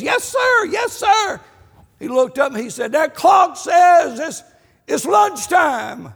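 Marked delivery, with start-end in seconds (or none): 0.0-1.4 very high, loud hurried reply; the wife running in to answer; wife calling back from the house, high and loud; eager wife. Retold or imitated. Wife answers husband's holler. 2.9-6.1 slow, loud, high bellow; husband demanding lunch from his wife, slow and loud; demanding shout. Retold or imitated.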